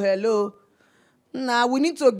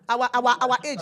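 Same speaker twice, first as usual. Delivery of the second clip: ordinary talk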